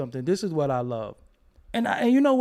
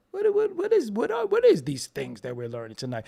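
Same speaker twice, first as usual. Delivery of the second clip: mock bafflement; 3 semitones higher than usual. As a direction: act stunned by the new word.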